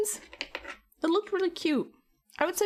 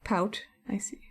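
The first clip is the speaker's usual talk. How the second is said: whisper; 3 semitones lower, 3 dB quieter than usual. Real, not acted. Aside to chat.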